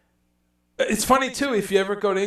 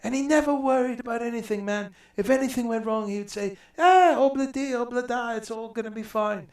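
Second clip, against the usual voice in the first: imitating [name]; 7 semitones higher, 4 dB louder than usual. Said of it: "Paul was like".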